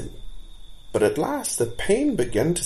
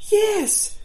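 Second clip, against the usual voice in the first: put-on much higher, louder child's yes; a small fawn piping up; eager fawn; 5 dB louder than usual. A narrator's reading. Another fawn chimes in.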